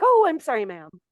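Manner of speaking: mock flustered correction, starting high and dropping; apologetic flight attendant. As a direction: imitate a startled, hasty apology. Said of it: "and they're like".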